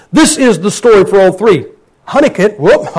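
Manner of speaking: usual speaking voice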